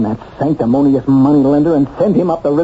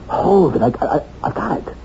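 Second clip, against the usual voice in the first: sudden realization; 7 semitones higher than usual. Acted. Sudden realization mid-explanation.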